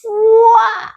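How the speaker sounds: high, drawn-out "fuaaa" whoosh; swelling high sound effect; imitated burst of light. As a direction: voice a huge light switching on. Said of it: "sentí una luz así".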